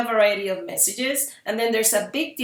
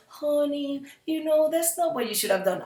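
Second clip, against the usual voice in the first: gentle sweet mom; 7 semitones higher than usual. Retold or imitated. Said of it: "I cannot be saying".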